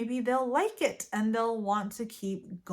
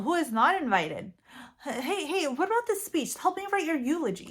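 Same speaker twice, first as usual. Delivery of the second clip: loud, higher mock-sarcastic taunting; rude; 5 semitones higher, 4 dB louder than usual. Mocking a loved one about planning their funeral, sarcastic. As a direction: shock them with brutal sarcasm. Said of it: "Be a bit, be a bit more rude".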